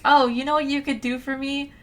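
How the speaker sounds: high, put-on wheedling voice; mock entitled sisters. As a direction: imitate a spoiled sister asking a favour. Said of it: "And then her sisters are like".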